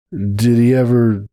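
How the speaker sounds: slow, hesitant question; a slow, unsure ask; asking slowly. Acted. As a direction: draw the question out carefully. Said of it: "Hoops asked slowly".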